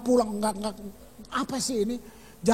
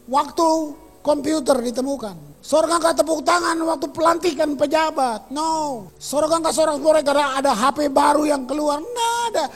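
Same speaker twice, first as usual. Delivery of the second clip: loud, driving sermon voice, higher and louder; preaching at full force; 4 semitones higher, 7 dB louder than usual. Acted. Pastor preaching loudly to the church, full force. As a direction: preach it out to the congregation.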